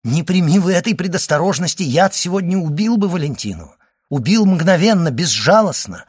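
high, urgent, emphatic exclamation; impassioned. A narrator's reading. Doctor on how the poison would have killed, impassioned. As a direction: drive it home with rising intensity.

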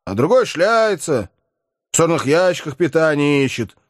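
high, crude, boozy lout holding forth; tipsy Sharikov ranting about sharing rooms; chatty Sharikov. A narrator's reading. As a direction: play a coarse upstart griping about sharing. Sharikov voiced at length.

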